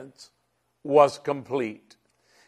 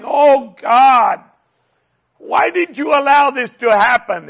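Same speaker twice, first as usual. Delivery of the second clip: anguished, much higher and louder outcry; crying out to God; 10 semitones higher, 9 dB louder than usual. Retold or imitated. Pastor crying out to God while driving, anguished high shout. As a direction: retell a desperate cry to heaven. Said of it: "And I'm crying out to God".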